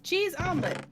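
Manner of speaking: high, imitated waitress call. Waitress calling customers from behind the counter, high mock holler. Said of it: "she was calling us from the".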